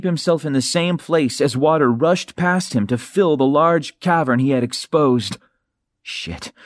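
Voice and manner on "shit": breathless whispered swear; a hushed desperate whisper of a curse. Acted. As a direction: curse under your breath.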